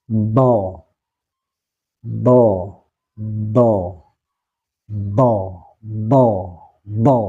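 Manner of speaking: "Do" thick, back of tongue lifted. Shown as a demonstration. Teacher demonstrating isti'la to students, thick "Do". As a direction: raise the tongue root for a heavy "Do". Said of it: "huruf do ini termasuk huruf tebal".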